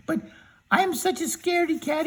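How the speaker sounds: storyteller voicing a high, timid cowardly lion. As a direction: beg way up high as the scared lion.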